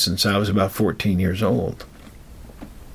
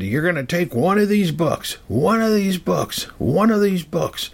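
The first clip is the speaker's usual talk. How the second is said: retold yelling; 10 semitones higher than usual. The man ordering the boy to take a book, mock yelling. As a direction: imitate the man yelling the order, not at full force. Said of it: "yelling in the library".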